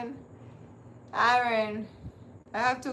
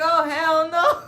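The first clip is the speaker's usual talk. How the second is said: much higher and louder, laughing-crying no way; loud refusal; 6 semitones higher, 10 dB louder, slower than usual. Retold or imitated. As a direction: shriek it in horrified amusement. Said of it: "I'm like".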